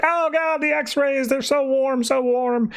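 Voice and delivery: very high-pitched hammed-up panic; comic falsetto freak-out; mock freak-out. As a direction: wail it in a squeaky fake panic. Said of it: "Mister Biscuit's freaking out".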